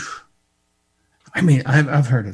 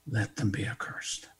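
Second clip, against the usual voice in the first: hushed whisper; 5 semitones lower, 11 dB quieter than usual. Acted. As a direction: whisper the curse, unvoiced and low. Repeats curse in hushed tone.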